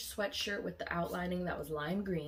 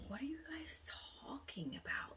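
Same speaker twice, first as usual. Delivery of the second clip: confused whisper; 8 semitones higher, 10 dB quieter than usual. Retold or imitated. Confused reply to reporter.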